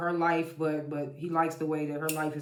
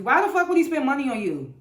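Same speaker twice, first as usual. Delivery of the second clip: high, loud, incredulous scolding; indignant outburst; 8 semitones higher, 6 dB louder than usual. Real, not acted. Recapper scolding the pastor's wife, loud incredulous outburst. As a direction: call her out, voice jumping up.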